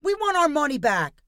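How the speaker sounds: high, loud demand; angry shout. A narrator's reading. Marcus shouting at Sergei for a refund, angry. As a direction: bark out the demand. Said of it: "shouted Marcus".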